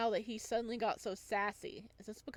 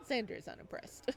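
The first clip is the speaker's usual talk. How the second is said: ordinary delivery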